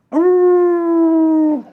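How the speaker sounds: long, high, loud wolf howl. Acted. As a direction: howl like a wild wolf at night. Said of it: "the occasional howling of the wild wolf".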